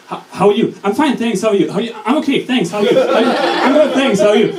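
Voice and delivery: high-pitched, chirpy mock pleasantries; flustered greetings. German student answering passers-by on campus, flustered and high-pitched.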